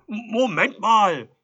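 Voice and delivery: very loud, high, drawn-out halt; calling to stop. A narrator's reading. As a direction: stutter into it and stretch it loudly. Edelstein stops the departing visitor.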